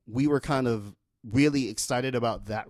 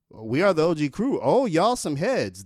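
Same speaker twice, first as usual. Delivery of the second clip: rapper on stage; 6 semitones higher, 6 dB louder than usual. Retold or imitated. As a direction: greet the fans like a rapper mid-show. Rapper quoted from record.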